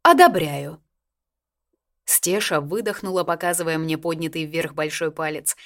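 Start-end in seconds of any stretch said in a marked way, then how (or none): 0.0-0.8 loud regal verdict; majestic approval. A narrator's reading. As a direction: pronounce it like a queen. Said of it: "величественно кивнула".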